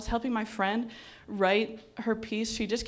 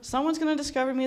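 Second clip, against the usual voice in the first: higher, quick mock excitement; acted hopeful excitement; 5 semitones higher, 4 dB louder, faster than usual. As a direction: rush it like a hopeful daydreamer. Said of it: "I'm like".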